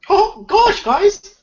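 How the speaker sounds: sped-up squeaky chipmunk voice. Real, not acted. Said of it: "get the chipmunk voice".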